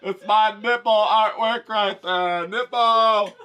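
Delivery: high, loud, slow squealing showoff; hammed-up gushing over her own art; showy female artist. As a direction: shriek it like a thrilled attention-seeker.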